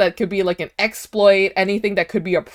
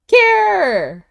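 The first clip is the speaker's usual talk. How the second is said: excited; 11 semitones higher, 6 dB louder than usual. Real, not acted. Greeting a subscriber excitedly.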